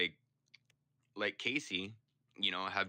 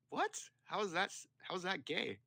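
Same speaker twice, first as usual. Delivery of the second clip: sharply higher disbelieving yelp; incredulous; 12 semitones higher than usual. Retold or imitated. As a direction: squeak out your disbelief. Incredulous retort to taunter.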